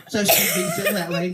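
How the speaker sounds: ordinary talk, nothing marked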